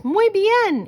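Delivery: high, loud Spanish exclamation; playful Spanish accent; Spanish flourish. A narrator's reading. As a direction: toss off muy bien with Mexican flair. Chihuahuas, Spanish aside.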